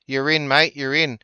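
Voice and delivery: ordinary talk, nothing marked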